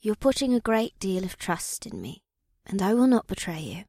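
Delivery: high, soft misty voice, sincere; earnest, high-pitched soft vow; deep sincerity. A narrator's reading. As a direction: pledge trust in a hushed mystic voice. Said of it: "replied with deep sincerity".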